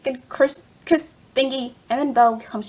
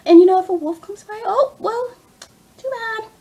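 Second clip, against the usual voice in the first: raised pitch, flippant imitation of a heartless mother; mock callousness; 6 semitones higher, 4 dB louder than usual. Vlogger imitating Cora abandoning her baby, mock callous. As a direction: toss it off in a high mocking voice. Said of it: "She was like".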